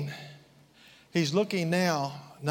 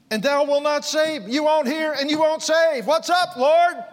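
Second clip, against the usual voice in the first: loud; 6 semitones higher, 4 dB louder than usual. Acted. Preacher voicing Habakkuk's complaint.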